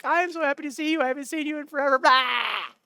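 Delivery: squealing very high, overjoyed greeting; gushing Maddie. Retold or imitated. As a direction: gush it out way up high like an excited friend. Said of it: "Maddie comes up to me and is like".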